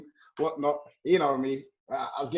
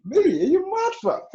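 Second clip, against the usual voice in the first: much higher, loud disbelief; incredulous squeal; 12 semitones higher, 4 dB louder than usual. Real, not acted. Denying he wears Mackenzie, squealing in disbelief. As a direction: shoot up in mock outrage. Outraged denial among friends.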